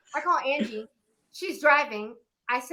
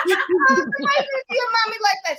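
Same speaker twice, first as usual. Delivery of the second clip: high-pitched playful scolding; mock reproach; 11 semitones higher, faster than usual. Playful mock reproach about mother.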